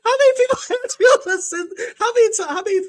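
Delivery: fast, high words through laughter; laughing while talking. Real, not acted.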